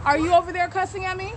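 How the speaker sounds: high, pointed accusation; confronting accusation. Real, not acted. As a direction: call him out for cursing. Confronting man who cursed her.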